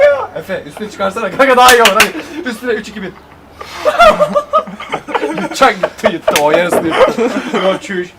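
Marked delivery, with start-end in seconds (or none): 3.6-5.4 bursts out in high laughter; loud shrieking laugh. Real, not acted. Friends laughing at food game.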